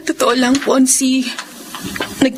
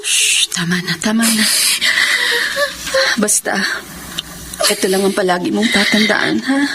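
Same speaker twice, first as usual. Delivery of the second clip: soothing hush; 6 semitones lower than usual. Acted. Older sister hushing her crying younger sister.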